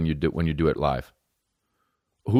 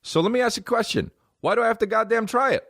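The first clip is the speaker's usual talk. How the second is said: high-pitched comic indignation; exasperated rant; 13 semitones higher than usual. Acted. Comedian fed up with being told to try poutine, exasperated. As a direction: demand an answer in a strained high voice.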